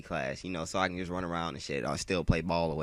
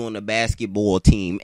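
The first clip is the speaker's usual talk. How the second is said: put-on louder New York accent on "basketball"; imitated New York accent; 9 dB louder than usual. Classmate begging him to join the team, imitated New York accent. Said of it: "that's exactly how she said it".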